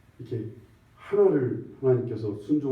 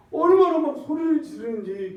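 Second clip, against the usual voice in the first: exclaiming; 13 semitones higher, 6 dB louder than usual. Real, not acted. Recalling the shouting scene.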